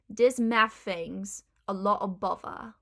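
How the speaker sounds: Cockney "Dis maff fing's a lo' of bovva"; Cockney, with D, F and V for each TH; Cockney accent. Shown as a demonstration. Teacher demonstrating the phrase, Cockney accent. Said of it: "they'll pronounce with a D".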